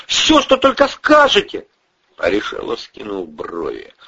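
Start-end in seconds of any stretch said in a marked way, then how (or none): 0.1-1.7 high, loud pleading; desperate promise. Acted. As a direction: offer anything at all to be spared. Young man begs desperately.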